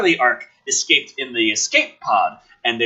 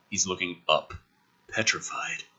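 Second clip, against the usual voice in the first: whispered dread; 7 semitones lower, 10 dB quieter than usual. Acted. Narration of fear, unvoiced.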